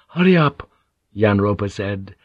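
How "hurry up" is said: high, loud hurry-up; impatient urging. A narrator's reading. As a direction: urge him on sharply. Thirsty man urges slow drinker.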